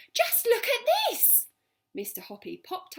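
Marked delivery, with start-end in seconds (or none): 0.1-1.5 high, loud, slow calls up the stairs; excited shout. Acted. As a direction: yell for him in wild excitement. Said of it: "she was shouting".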